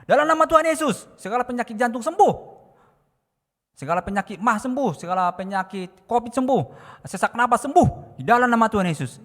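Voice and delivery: very loud, high healing declaration; loud, high commanding prayer; re-enacted prayer shout. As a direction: cry out against the sickness with full force.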